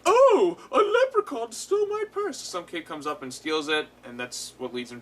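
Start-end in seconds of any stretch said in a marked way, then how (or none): 0.1-2.4 high, loud posh-lady shriek; sky-high mock rich lady. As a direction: squeal it like a fussy rich lady. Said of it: "some rich lady's like".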